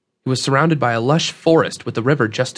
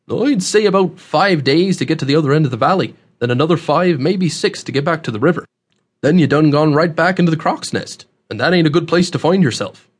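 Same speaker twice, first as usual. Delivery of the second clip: folksy country dialect, swinging emphasis; rustic old man, explaining; 4 semitones higher than usual. Acted. Host explains the rock cliffs to newcomer, rustic voice. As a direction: explain it like a seasoned backwoods local.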